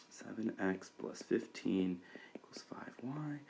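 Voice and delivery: soft whisper, reading back seven x plus fifteen equals five y; whispered to self. Real, not acted. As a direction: whisper the equation under your breath while writing. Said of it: "We have to divide by five".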